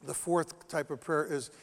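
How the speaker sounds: ordinary talk, nothing marked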